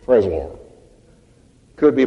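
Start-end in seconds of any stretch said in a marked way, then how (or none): 0.0-0.6 half-hearted, trailing off into a mumble; lukewarm mock praise. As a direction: shrug it off like it means nothing. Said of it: "He's not saying".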